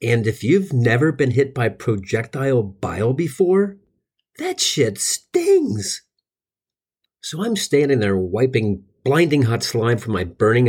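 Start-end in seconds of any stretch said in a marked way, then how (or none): 4.4-6.0 squeaky drawn-out whine. Acted. Comic punchline, drawn out.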